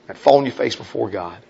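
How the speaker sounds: normal talking voice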